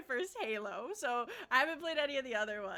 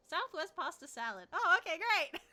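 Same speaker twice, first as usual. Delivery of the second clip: high mock relief; 6 semitones higher, 6 dB louder than usual. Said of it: "And that just".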